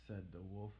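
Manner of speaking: usual speaking voice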